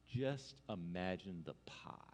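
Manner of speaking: soft, low and slow invitation; hushed imagining. Pretend. Preacher guiding the congregation to imagine, hushed and slow. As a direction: lull them into picturing it, low and slow.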